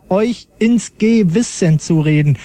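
sounding out words. Shown as a demonstration. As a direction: preach it syllable by syllable. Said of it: "Silbe für Silbe absetze".

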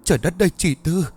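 high, urgent cry of alarm, moderately loud; acted high-pitched distressed outcry. A narrator's reading. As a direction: cry out in horrified protest. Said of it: "bà Hằng liền hét lớn".

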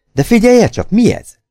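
sudden high, urgent question; startled alertness. A narrator's reading. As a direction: alert your companion to a sound, pitch jumping up. Holmes suddenly hears a sound. (riadtan felfigyelve)

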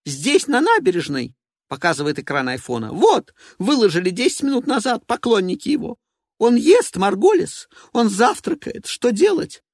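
high, loud and frantic; a diva in full panic; shrill panicked woman. Acted. Evelina panicking.

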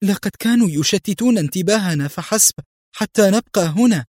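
high, heated explanation; worked-up and insistent; agitated. A narrator's reading. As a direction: press the point urgently, pitch up. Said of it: "في انفعال".

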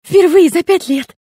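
put-on high, loud, slow childish wail; panicked little girl. A narrator's reading. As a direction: squeal it in alarm. (испуганный детский вопль)

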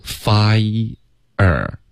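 "fire" split into two slow syllables; "fai", then "er", pulled apart. Shown as a demonstration. Teacher shows where "fire" divides, syllable by syllable. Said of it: "它的第一个音节是发音".

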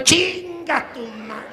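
high, slow strangled father's curse; hammed-up furious king; outraged father. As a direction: cut off mid-curse, choking with outrage. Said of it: "El padre del príncipe dijo".

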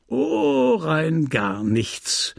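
long, high drawn-out oh, then slow drop; slow, scornful stretched-out dismissal; dismissive refusal. A narrator's reading. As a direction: brush the plea off with mock regret. Merchant dismisses her plea.

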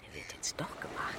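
conspiratorial whisper. Acted. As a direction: hiss the plan under breath. Conspiratorial plotting aside.

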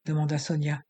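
ordinary delivery

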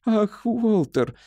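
high, drawn-out wifely lament; plaintive moan. A narrator's reading. Wife moans at husband's morbid talk, plaintively. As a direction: moan it slowly, pitched high. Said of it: "жалобно простонала Этель".

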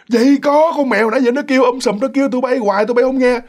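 high, agitated arguing; acted insistent protest. Scared girl argues with roommates about the meowing cat, insistent protest.

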